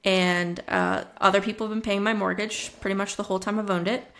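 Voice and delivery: normal talking voice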